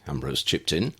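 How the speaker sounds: usual speaking voice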